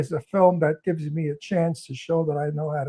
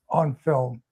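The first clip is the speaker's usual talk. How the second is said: ordinary talk, nothing marked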